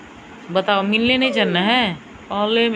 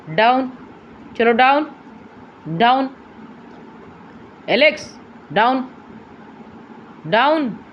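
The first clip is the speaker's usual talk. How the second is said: firm command; 5 semitones higher than usual. Real, not acted. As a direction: bark the order down at the dog. Commanding dog to get down.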